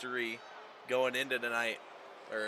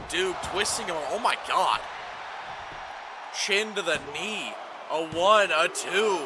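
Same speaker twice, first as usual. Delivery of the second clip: louder, higher, startled ringside commentary; excited near-fall call; 5 semitones higher, 3 dB louder than usual. Real, not acted. Commentator reacting to a chin to the knee, excited. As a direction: call the pinfall with rising excitement.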